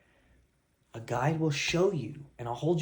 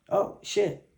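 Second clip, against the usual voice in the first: higher, louder fake shock; mock surprise; 6 semitones higher, 3 dB louder than usual. Coach teasing client with hammed-up surprise. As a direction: act startled, teasing. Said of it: "I'm gonna say".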